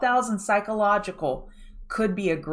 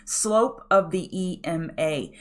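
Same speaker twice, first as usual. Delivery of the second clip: slow, drawn-out catchphrase, every word spaced; catchphrase said slowly; slower than usual. Shown as a demonstration. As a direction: lay out each word of her signature phrase slowly. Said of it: "I don't think I've said Sotima in here for a while".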